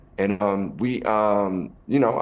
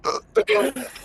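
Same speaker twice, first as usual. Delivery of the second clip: high laugh. Real, not acted. Laughs at his own story.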